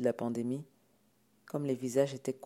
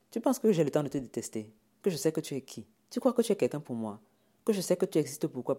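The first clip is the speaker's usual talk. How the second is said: louder, exasperated rhetorical questions; scornful tirade; 6 dB louder than usual. Acted. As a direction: fire questions to put him down. Sipora tells Jacques off. (tirade méprisante)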